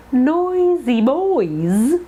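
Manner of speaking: put-on "Noisy boys" stretched very slowly; teacher slowing down the oi sound; very slow drawn-out. Shown as a demonstration. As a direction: lean into each oi. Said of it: "the oi sound".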